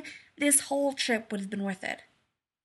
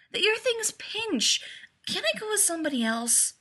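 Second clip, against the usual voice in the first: high, dragged-out whiny complaint; plaintive, stretched-out griping; whining; 9 semitones higher than usual. A narrator's reading. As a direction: whine like a reluctant kid. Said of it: "he whined".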